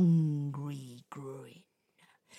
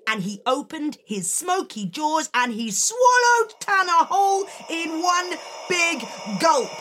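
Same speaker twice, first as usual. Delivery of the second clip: higher, louder building climax; dramatic narration; 10 semitones higher, 6 dB louder than usual. A narrator's reading.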